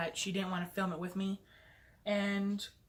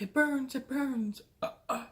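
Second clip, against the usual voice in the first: mimicking crying; 5 semitones higher than usual. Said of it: "screaming and crying".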